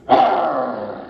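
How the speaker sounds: low mock bear growl; playful growl. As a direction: growl like a grumpy little bear. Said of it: "He growled".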